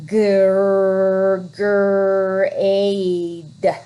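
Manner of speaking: grade stretched slowly, sound by sound; segmenting grade. Shown as a demonstration. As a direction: drag out grade. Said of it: "sounding out grade".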